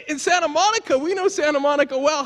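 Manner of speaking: high-pitched incredulous protest; retold disbelief. Speaker to couple lost in Santa Monica, incredulous.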